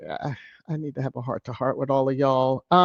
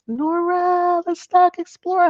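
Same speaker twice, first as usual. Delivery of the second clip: playful greeting; 13 semitones higher, 4 dB louder than usual. Real, not acted. Host calling out to viewer Nora, high and playful. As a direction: sing her name out high.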